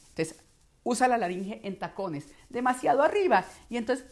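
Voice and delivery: normal talking voice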